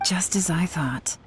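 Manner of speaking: a breathy aside meant for no one; whispered aside. Acted. Investigator confirming a suspicion under her breath, whispering. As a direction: whisper "Just as I thought." to yourself. Muttered to self, kid asks huh.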